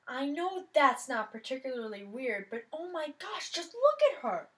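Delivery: put-on bursting with gossip about the new girl; excited. Girl nudging her friend, excitedly pointing out the newcomer.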